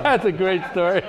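delighted laughing praise; laughing while talking. Real, not acted. Moderator laughing, praising the LBJ story.

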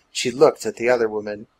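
ordinary delivery, nothing marked

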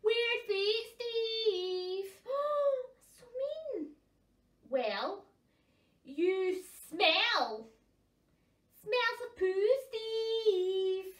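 high, loud mocking jeer; taunting. Puffins trading playground insults, loud and mocking. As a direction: sneer the insult.

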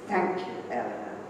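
low, soft and heartfelt thanks; a quiet, tender tribute at her grave; sincere farewell. Real, not acted. Closing personal tribute at memorial.

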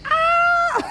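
high loud cry then laughter; startled shout. Real, not acted. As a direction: gasp out loud as she crashes. Dog bumps its head.

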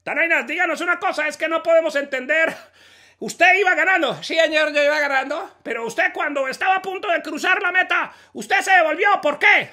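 high excited radio interviewer. Acted. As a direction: question him like an eager radio host.

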